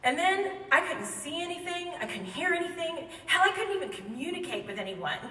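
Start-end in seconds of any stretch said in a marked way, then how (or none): none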